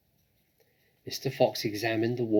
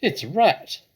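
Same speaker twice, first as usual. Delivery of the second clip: startled badger; 9 semitones higher, 11 dB louder than usual. A narrator's reading. Badger spots the rat.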